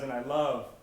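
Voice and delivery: bold announcement. Retold or imitated. Girl announcing at the mic.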